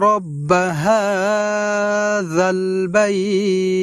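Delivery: slow chanted recitation; Quran recitation. Acted. Teacher reciting the verse for viewers, chanted slowly. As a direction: intone the verse slowly, melodically.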